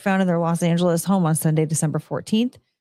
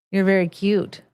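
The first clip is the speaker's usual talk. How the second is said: cooing to a pet. Real, not acted. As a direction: coo to the cat sitting nearby. Cooing to pet while streaming.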